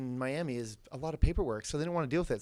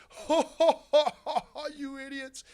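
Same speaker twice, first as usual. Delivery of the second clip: high mocking ho ho ho cackle; cackling laugh; 10 semitones higher than usual. Cop mocking the caught tourists, cackling high. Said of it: "cackling laughing".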